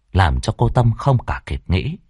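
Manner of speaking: ordinary delivery, nothing marked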